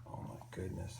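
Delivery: breathy, hushed "Oh my goodness"; hushed gasp. A narrator's reading. Storyteller hushes the children with amazement, whispered. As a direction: drop to a near whisper in wonder. Narrator hushed exclamation to listeners.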